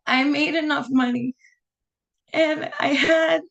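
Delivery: high, choked-up tearful voice; holding back real tears of relief. Real, not acted. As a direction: voice breaking with happy tears. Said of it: "I'm gonna start crying".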